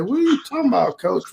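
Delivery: high-pitched incredulous question; retold disbelief. Player questioning coach's advice to go home, retold disbelief. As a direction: imitate being thrown by the news.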